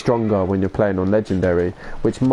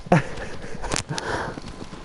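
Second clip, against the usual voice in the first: quiet laugh; 4 semitones higher, 8 dB quieter than usual. Real, not acted. Quiet laugh after joke.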